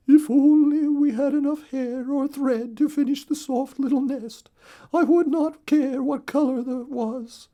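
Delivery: very high, loud little bird wife voice; Missus Weaver voice. Acted. Missus Weaver wishing for nest thread, squeaky high bird voice.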